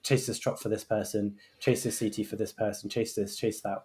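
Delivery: half-hearted imitation of a monotone handover; bored drawl. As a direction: rattle off the jobs like a tired handover. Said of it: "drawling through a list".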